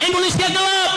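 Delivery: high, sudden slogan cry; student protest shout. Acted. Students voice criticism of officials, high shouted slogan. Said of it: "Mahasiswa teriak".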